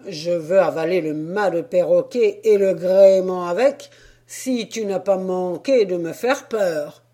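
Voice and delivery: loud, hearty sailor talking to a donkey. Acted. Sailor addressing the penned donkey, loud hearty voice. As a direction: talk like a jolly sailor.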